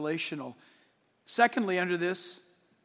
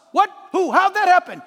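higher, louder mock surprise; mock astonishment; 7 semitones higher, 8 dB louder than usual. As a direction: act out the crowd's disbelief. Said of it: "voicing shocked listeners".

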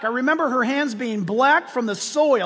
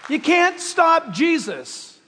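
usual speaking voice

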